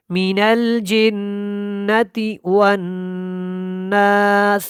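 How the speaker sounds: drawn-out chanted tajweed recitation; slow Quran recitation. Shown as a demonstration. Tajweed teacher demonstrating recitation to students, slow chant. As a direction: intone each word slowly, melodically.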